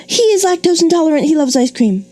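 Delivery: high, fast run-on with no pause; rushing through the run-on; rushed run-on. Shown as a demonstration. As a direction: blurt both clauses in one fast breath. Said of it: "they run on and on".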